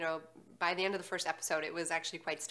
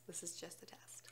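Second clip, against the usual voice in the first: whispering; 2 semitones lower, 19 dB quieter than usual. Pretend. Joke aside, whispered.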